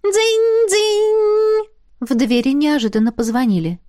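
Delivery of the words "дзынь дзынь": high sung ding-dong; sung doorbell chime. Acted. Doorbell rings at the door, sung chime. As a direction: chime it like a bell.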